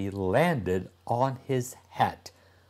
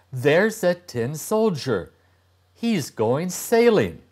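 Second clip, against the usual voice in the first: put-on higher, eager boy's voice; a boy delighted by his find; excited urchin; 6 semitones higher than usual. A narrator's reading. Street urchin tells his friend, higher and eager. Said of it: "one said".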